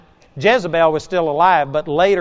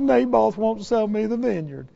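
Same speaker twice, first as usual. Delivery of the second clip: higher, sulky complaining voice; whiny king; 5 semitones higher than usual. Retold or imitated. Crying king complaining to his wife, higher sulky whine. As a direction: pout like a spoiled child.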